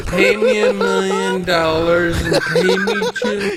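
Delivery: slow, laughing mock whine; laughing mock actor voice. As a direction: ham it up, laughing.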